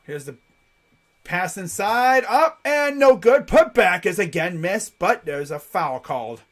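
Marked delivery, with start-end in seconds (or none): 1.2-6.4 drawn-out rising call that settles at the whistle; excited basketball commentary; live play-by-play. Real, not acted. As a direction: call the play as it happens, lifting on the shot.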